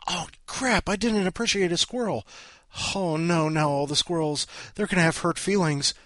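high-pitched playful dismay; mock panic. Host forgot Squirrel Appreciation Day, mock panic.

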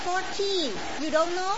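put-on mild foreign-accented mother-in-law; broken English. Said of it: "with her broken English".

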